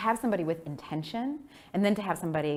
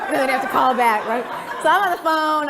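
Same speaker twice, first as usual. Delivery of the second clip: ordinary talk, nothing marked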